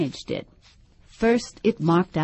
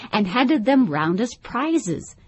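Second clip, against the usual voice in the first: plain reading voice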